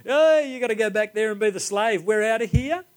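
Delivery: high, jeering mock laugh, then gloating; hammed-up taunt with a fake laugh; mocking laughter. Brothers ditching Benjamin in trouble, mocking him. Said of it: "point at him and laugh and go".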